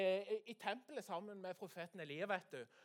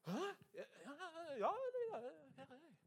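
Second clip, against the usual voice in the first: put-on woken boy Samuel; 9 semitones higher, faster than usual. Samuel woken once more answers, high sleepy stammer.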